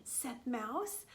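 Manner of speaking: usual speaking voice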